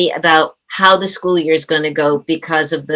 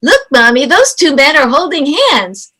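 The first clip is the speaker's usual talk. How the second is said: put-on high-pitched, slightly raised kid voice; little megaphone child voice; 6 semitones higher than usual. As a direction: pipe up like a child everyone around can hear. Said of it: "little megaphone voice they have where everybody around you hears".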